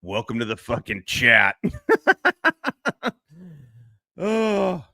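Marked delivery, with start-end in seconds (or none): 1.6-3.1 high loud laugh. Real, not acted. Still cracking up at clip.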